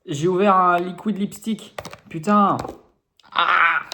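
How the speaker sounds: loud, slow frustrated complaint; exasperated. Real, not acted. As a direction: vent loudly at your own slip. Cursing own mistake.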